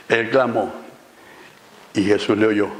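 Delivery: ordinary delivery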